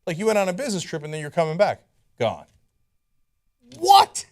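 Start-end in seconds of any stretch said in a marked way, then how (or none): 3.8-4.3 high incredulous yelp; shrill disbelieving outburst; shocked what. Real, not acted. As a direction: blurt it out in disbelief. Host reacting to outrageous plan.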